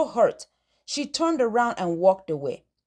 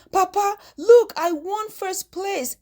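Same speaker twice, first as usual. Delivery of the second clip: higher and louder, then slower; bursting with excitement; 7 semitones higher, 3 dB louder than usual. Acted. Girl running to her dad with her prize, thrilled. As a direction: shout it out, thrilled to show Papa. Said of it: "bursting with excitement".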